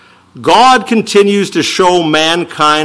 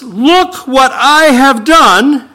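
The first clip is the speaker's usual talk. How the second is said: very slow, higher, proud "Look what I have done"; hammed-up pride, pitched up and stretched; arrogant boast; 9 semitones higher, 4 dB louder, much slower than usual. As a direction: show off like Eve bragging, drawn out. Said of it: "She says".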